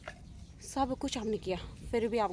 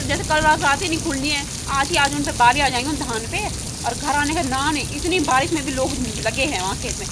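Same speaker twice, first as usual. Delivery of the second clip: louder, fired-up complaining over the rain; loud rant; 3 semitones higher, 8 dB louder than usual. Real, not acted. Complaining to viewers about working in the rain, a loud rant. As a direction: vent loudly about the villagers working in the rain.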